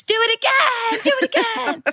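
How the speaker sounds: mock scream, very high pitched. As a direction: shriek it playfully at the top of your lungs. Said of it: "I would have screamed at the top of my lungs".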